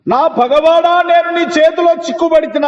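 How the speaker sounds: preacher proclaiming Ahab's cry to Elijah; fervent scripture cry. Retold or imitated. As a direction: cry it out like an accusation.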